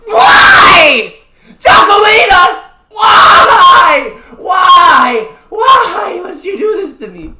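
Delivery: high, loud, slow wailing cries; mock despair howled at the game; hammed-up wail of betrayal; anguished shout. Gets an unwanted gacha character.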